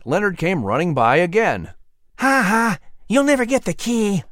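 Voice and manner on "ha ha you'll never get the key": taunting lizard voice. Acted.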